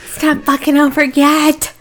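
high-pitched mock heckle at the screen; mock loud moviegoer. Moviegoer heckling the film to his partner, mock loud. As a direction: imitate a heckler, pitched well up. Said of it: "talking rather loudly to his partner".

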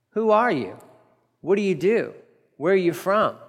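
ordinary delivery

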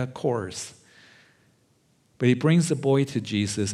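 usual speaking voice

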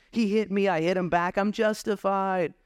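mock sibling argument; whiny sibling. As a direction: plead your case like a squabbling child. Squabbling sibling voiced.

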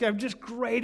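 ordinary talk, nothing marked

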